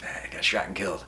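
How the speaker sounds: low, quick, soft throwaway; casual, downbeat deadpan; deadpan reply. Acted. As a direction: toss it off quietly, no big deal. Another kid's casual reply.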